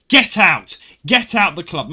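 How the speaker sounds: mock yell ordering someone out; retold shouting. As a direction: shout it as the angry woman. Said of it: "she was shouting".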